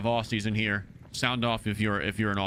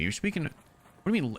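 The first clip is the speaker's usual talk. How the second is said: ordinary delivery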